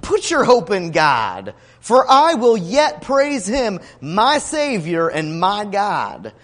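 slow, forceful declaration of faith; scripture declamation. Retold or imitated. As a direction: proclaim it slowly, weighting each phrase. Declaims the psalm's answer.

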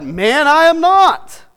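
loud slow high denial; Peter's denial. Retold or imitated. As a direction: stamp it out firmly. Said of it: "Peter replied".

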